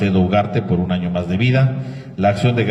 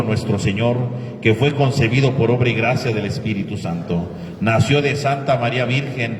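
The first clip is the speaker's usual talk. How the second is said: recited creed; 5 dB louder than usual. Acted. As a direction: recite the creed steadily with the congregation.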